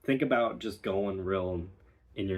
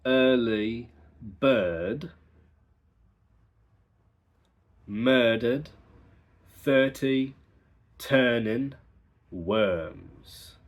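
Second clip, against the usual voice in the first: slow, R-less practice sentence; careful non-rhotic reading; non-rhotic drill; 3 dB louder, much slower than usual. Shown as a demonstration. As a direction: drop every R, slowly. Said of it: "The practice sentence is".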